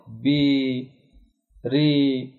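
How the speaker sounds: बी, री with long ee held; drawn-out ee. Shown as a demonstration. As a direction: stretch the ee in बी and री. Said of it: "या मद्दी कहलाती है". (या को खींचकर बी, री)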